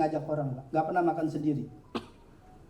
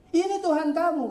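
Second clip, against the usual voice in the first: much higher and louder demand; stern challenge; 13 semitones higher, 4 dB louder than usual. Acted. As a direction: confront him sharply. Ibrahim mocks the idol.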